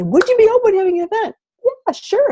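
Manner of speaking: high, quick bright ask and answer; eager back-and-forth; chirpy invite. Retold or imitated. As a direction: pitch it up high and fast. Quoting herself and customer, high fast.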